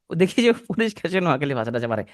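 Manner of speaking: normal talking voice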